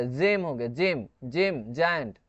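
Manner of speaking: slow j-sound examples; soft g as j. Shown as a demonstration. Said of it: "ये ज प्रोनाउंसिएट होता है". (जेम, जायंट में ज की ध्वनि)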